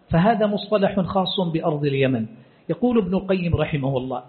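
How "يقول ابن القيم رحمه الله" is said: usual speaking voice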